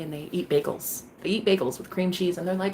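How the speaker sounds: ordinary talk, nothing marked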